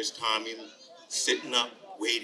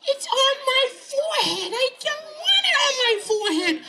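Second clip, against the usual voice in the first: put-on very high, squealing child voice; squeaky boy in dismay; horrified little boy; 5 dB louder than usual. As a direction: wail it like an upset small kid. Tommy shocked at his forehead.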